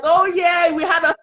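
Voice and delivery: bubbly laughing reply; laughing retold reply. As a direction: replay your own giggly answer to your husband. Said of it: "you are laughing and you are gisting".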